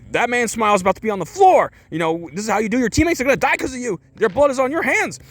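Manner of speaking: very high, strained coach rant; building high-pitched mock tirade; freaking out. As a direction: imitate a coach flipping out on his team. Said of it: "He's, like, freaking out".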